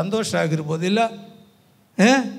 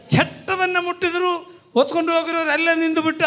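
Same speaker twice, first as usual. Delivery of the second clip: high-pitched rapid proclamation; excited preaching; 11 semitones higher, 3 dB louder, faster than usual. Real, not acted. Preacher telling how the bearers stopped, excited preaching. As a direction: race through it at a high pitch.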